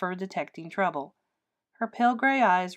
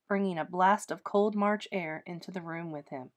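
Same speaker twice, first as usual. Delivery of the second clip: ordinary delivery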